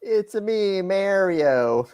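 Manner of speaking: high Mario voice; Mario impression. S1 teasing chat with a high video game plumber voice. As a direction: toss off the plumber's catchphrase. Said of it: "it doesn't work that way".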